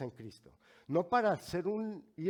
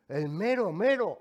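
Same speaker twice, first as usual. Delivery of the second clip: "El mero mero" called out much louder and higher; proud boast; 4 semitones higher, 9 dB louder than usual. Shown as a demonstration. To the office clerk, "El mero mero" said louder and prouder. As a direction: announce "El mero mero" like a trump card. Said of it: "como decimos en México".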